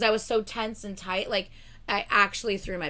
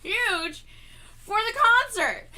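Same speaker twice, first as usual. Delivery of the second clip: ordinary delivery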